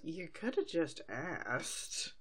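slow, quiet, dry sheep. Acted. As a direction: drawl it out like a deadpan sheep. Sheep voiced in a recap.

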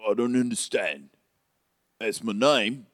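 puzzled character voice; baffled storybook helper; Hagrid. Acted. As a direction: protest innocently in a put-on accent. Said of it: "Rubeus Hagrid, at your service".

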